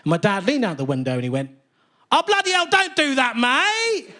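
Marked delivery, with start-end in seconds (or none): 2.1-4.1 sudden cockney geezer voice; Mickey Flanagan cockney. Retold or imitated. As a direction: do the dad as a chirpy London bloke. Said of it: "he turned into Mickey Flanagan".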